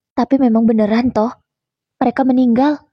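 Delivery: ordinary delivery, nothing marked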